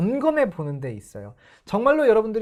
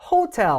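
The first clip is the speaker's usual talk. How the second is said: 호텔 with 호 punched high, 텔 falling away; stress on 호; 11 semitones higher, 5 dB louder than usual. Shown as a demonstration. Teacher mimics the learners' guess, stress on 호. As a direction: hit the 호 of 호텔 hard. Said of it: "호에다 강세를 넣어서".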